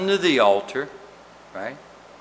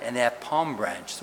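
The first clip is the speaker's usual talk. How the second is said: normal talking voice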